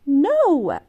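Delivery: loud defiant no; shouted no. A narrator's reading. Girl shouting no at her parents, loud. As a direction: refuse through the door. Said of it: "shouted Elizabeth".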